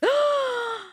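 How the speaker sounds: high dramatic gasp; sharp, scandalized intake of breath; shocked gasp. Acted. Shock at the second wife reveal.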